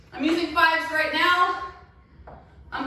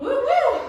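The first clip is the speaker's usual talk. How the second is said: laughing whoops; 10 semitones higher, 5 dB louder than usual. Real, not acted. Laughs then cheers after round.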